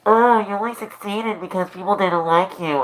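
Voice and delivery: a hint of a snide critic; mocking critics. Streamer imitating critics in chat, snide and high. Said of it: "people are like".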